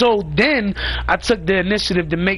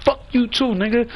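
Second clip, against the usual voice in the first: retold comeback; 5 semitones higher than usual. Firing back at rivals.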